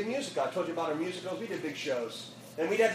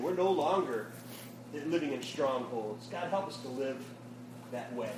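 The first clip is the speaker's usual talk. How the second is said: closing prayer; 4 semitones lower than usual. Acted. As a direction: pray sincerely over the students.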